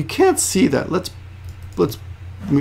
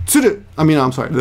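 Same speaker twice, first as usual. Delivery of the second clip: ordinary delivery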